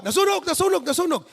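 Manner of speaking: high mock alarm cry; a neighbour yelling fire, hammed up; mock shout. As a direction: call it out in a fake panic. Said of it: "Yung iba, sumigaw".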